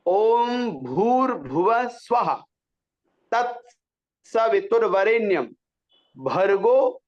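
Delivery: slow mantra syllables, aspirated bh in भूर्भुवः and भर्गो; clear, complete articulation. Shown as a demonstration. Teacher demonstrates the mantra to students, precise भर्गो. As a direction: enunciate भूर्भुवः fully with a puff on each bh. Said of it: "स्पष्ट पूरा बिल्कुल".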